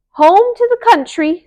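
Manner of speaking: high, loud mouse squeak. A narrator's reading. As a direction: squeak while running off. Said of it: "squeaked Annie".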